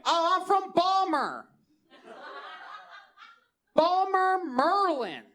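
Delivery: put-on drunken Baltimore holler, high and loud; hammed-up drunk Baltimore accent; thick Baltimore accent. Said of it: "my Baltimore accent gets real thick".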